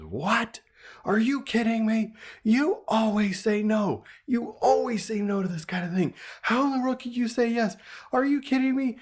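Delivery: high-pitched imitation of indignant disbelief; incredulous wife. Wife challenging husband's yes on the phone, high incredulous protest. Wife's outraged reaction retold.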